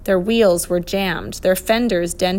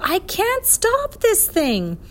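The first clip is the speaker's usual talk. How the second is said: high, drawn-out yell; high-pitched cry of alarm; panicked yell; 13 semitones higher, slower than usual. A narrator's reading. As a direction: yell it slowly as if carried off on a runaway bike. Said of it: "in which to yell".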